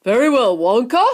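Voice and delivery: a hint of pompous father, loud; self-important, booming. Mister Salt addressing Wonka, mildly pompous and loud. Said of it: "he said importantly".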